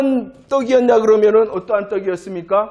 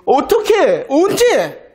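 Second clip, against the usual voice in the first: higher, incredulous questioning of God; indignant protest; 5 semitones higher than usual. Retold or imitated. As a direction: demand when and how, doubtful. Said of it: "라고 그들은 항변하며 대꾸했습니다".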